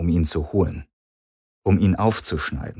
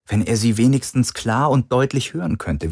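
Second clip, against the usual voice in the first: plain reading voice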